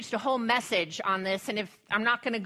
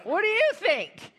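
mock clueless God; 4 semitones higher than usual. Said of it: "God's like".

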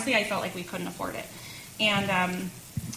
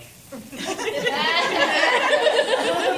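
loud laughter; 11 semitones higher, 8 dB louder than usual. Real, not acted. Laughing at husband's reaction.

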